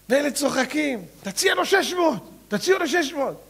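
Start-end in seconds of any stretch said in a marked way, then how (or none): 1.2-3.4 high egging-on voice; urging friends. Acted. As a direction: egg him on.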